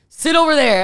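high, loud exclaimed command; alarmed order. Retold or imitated. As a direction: order someone away in sudden alarm. Retold alarmed assistant after positive result.

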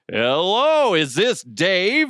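loud, high-pitched contest-host greeting; mock radio host booming down the line; hyped radio host. Prank caller rings a listener at night, hyped radio host.

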